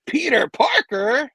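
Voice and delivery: high, loud, drawn-out disbelief; incredulous fan blurting a name; astonished. Acted. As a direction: stretch the name in shock. Acting fan's shock at identity reveal.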